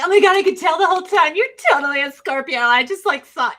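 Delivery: high, loud, fast gushing impression; mocking gush. People who believe in zodiac signs, gushing high and fast. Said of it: "And they're like".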